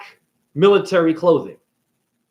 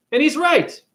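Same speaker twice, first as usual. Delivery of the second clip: normal talking voice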